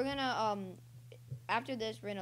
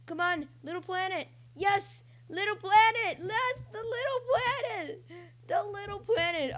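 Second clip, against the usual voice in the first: excited cheer; 11 semitones higher, 7 dB louder than usual. Real, not acted. Player cheering on the little planet, excited. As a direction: whoop it up, stretching the words high.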